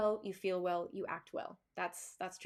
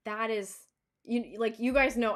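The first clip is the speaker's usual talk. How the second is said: ordinary talk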